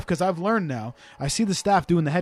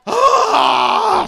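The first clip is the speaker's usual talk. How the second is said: put-on high wailing kid's scream; mock tantrum shriek; 5 dB louder than usual. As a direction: shriek like a stamping boy. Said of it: "stamping his feet".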